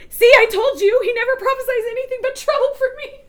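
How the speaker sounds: high-pitched, amused I-told-you-so; mock complaint. Ahab grumbling to Jehoshaphat about the prophet, mock complaint.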